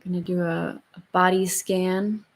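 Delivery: slow, soft meditation voice; calm and unhurried, gently guiding; guided meditation. Acted. Leads the closing meditation.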